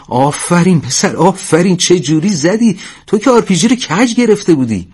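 high, delighted cheering for a friend; excited praise. Acted. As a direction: praise him in high-pitched amazement.

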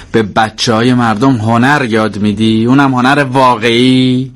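sarcastic mother. Acted. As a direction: needle the daughter with lofty compliments. Mamani mocks Maryam's teaching.

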